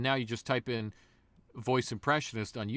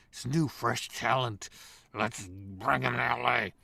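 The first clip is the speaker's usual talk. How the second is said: drooling eagerness; 3 dB louder than usual. Retold or imitated. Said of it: "they're drooling".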